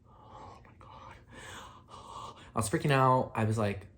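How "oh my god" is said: slow, breathless whisper of panic; whispered oh my God. Retold or imitated. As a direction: whisper it in shock, barely voiced. Panicking at the covid test rule.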